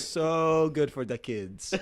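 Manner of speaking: slow Jamaican mimicry with hard d's; Jamaican-sounding accent. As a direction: drawl it in a mock Jamaican accent. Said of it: "he says "duh".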